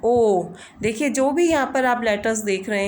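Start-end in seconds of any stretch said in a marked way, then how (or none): 0.0-0.4 ओ held long and open; drawn-out o. Shown as a demonstration. Teacher showing the long ओ of born, drawn out. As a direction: stretch the ओ out. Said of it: "ओ को हम थोड़ा खींचते हैं".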